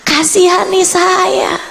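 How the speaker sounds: high, drawn-out plea; retold pleading prayer. Woman begging God for mercy, high slow pleading. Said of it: "mulai berdoa".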